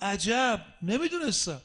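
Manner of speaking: retold astonishment. Old man answering the Imam, acted astonishment. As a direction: act out the old man's surprised realisation.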